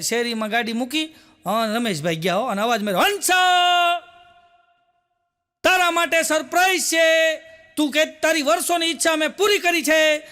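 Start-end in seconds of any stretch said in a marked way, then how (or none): 3.0-10.2 calling out proudly. Retold or imitated. Husband calls up to wife proudly.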